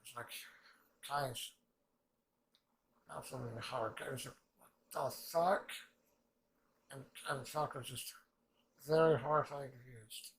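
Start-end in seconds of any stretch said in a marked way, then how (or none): 4.9-5.7 loud disbelieving "What the fuck?" rising at the end; voicing a stunned character; baffled exclaim. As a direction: blurt it out in shock. Mock reaction of baffled character.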